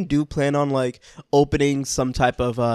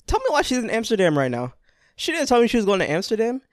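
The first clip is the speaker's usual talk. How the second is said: high-pitched, rushed mock complaint; can-you-believe-this playful grievance; mock indignation; 8 semitones higher, faster than usual. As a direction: play scandalized, quick and high. Mock outrage at absent co-host.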